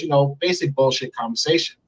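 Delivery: usual speaking voice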